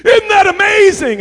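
high, loud imitation of amazement; hyped-up teenager. Student marvelling to her pastor at her calling, hyped up. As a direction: gush it at full voice. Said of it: "all hyped up".

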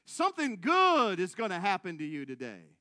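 hint of a television preacher's promise; Oral Roberts. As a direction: announce it like a TV evangelist. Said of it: "He said".

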